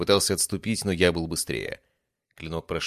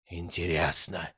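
rustling insect voice; slower than usual. Acted. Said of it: "прошелестел ткач голосом, похожим на шуршание тысячи насекомых".

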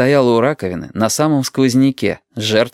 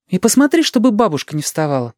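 busy mother; 9 semitones higher than usual. A narrator's reading. Mother giving parting instructions.

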